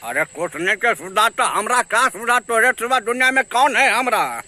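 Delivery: quick mimicry of a rustic politician; Lalu Yadav. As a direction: rattle it off as a folksy Bihari leader. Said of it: "Lalu Yadav का हो".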